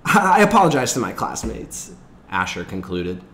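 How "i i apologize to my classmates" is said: high, loud, stammering excuse; flustered schoolboy explanation. A narrator's reading. Asher explains his lateness to the class, high and flustered. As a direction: stumble through an eager, high-pitched apology. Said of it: "they had listened to Asher's explanations".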